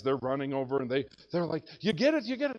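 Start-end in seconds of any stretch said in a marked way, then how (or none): 1.8-2.6 eager question. Retold or imitated. Hunting buddies running over, asking.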